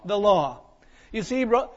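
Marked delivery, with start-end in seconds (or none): none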